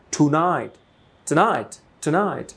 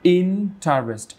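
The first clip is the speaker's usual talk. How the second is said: Interest slowly, with IN punched higher; stress on first syllable; 4 semitones higher, much slower than usual. Shown as a demonstration. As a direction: break Interest up, leaning hard on "IN". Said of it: "stress আছে এই অংশে".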